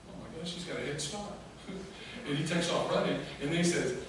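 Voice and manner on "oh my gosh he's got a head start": soft aside to himself; muted dismay. Acted. John watching Peter take off first, muted dismay.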